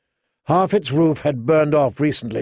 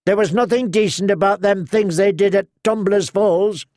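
mild growl; 6 semitones higher than usual. Acted. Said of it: "the townsman growled right back".

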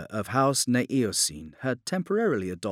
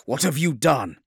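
raised, louder accusing question; angry demand; 6 semitones higher, 7 dB louder than usual. A narrator's reading. The Lion confronting the puppet servitor, demanding. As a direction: demand an answer sharply. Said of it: "the lion demanded".

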